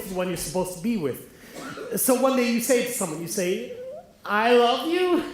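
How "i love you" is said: slow, tentative I love you with a questioning lift; hesitant, unsure declaration of love. Retold or imitated. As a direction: offer "I love you" slowly, half asking it. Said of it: "phrase it as a question".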